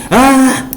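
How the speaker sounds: sudden high shriek of shock; startled scream. Acted. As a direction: shriek as if the scroll frightened you. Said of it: "Why did you scream like that?".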